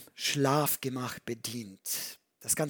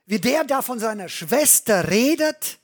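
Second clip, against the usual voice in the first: laut und viel höher, empört an David gerichtet; empörter Ausruf; 8 semitones higher, 8 dB louder than usual. Retold or imitated. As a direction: rufe David aufgebracht zur Rede. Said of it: "dann kommt mir der Gedanke". (laut empörter Zwischenruf an David)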